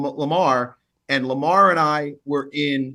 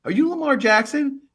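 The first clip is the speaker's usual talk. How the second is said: higher, eager disbelief; excited fan question; 10 semitones higher than usual. Retold or imitated. Kid in the store recognizing Lamar, excited and higher. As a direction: voice the kids recognizing a star. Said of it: "They're like".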